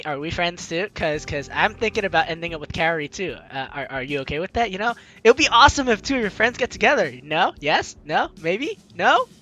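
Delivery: ordinary talk, nothing marked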